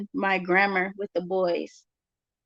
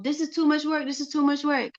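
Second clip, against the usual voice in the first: put-on higher, complaining child voice; mock whine about the work; whiny son; 6 semitones higher than usual. Son complaining about schoolwork, higher whine. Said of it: "He'll say".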